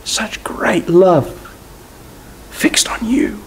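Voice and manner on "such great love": ordinary delivery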